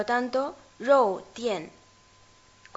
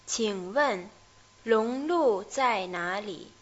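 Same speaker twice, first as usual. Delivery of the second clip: slowly, again; much slower than usual. Shown as a demonstration. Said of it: "vamos a ver lentamente este diálogo".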